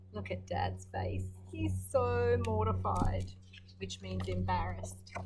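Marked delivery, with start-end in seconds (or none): none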